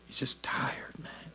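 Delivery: whispered aside; breathy whispered aside. Acted. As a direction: lean in and whisper it.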